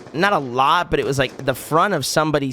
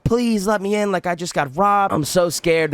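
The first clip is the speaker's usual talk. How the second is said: raised-pitch pleading, as when freaking out; retold panicked plea; 5 semitones higher than usual. Robbery victim begging a stranger to let him in, freaking out. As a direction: imitate your own frantic begging. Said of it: "I'm freaking out".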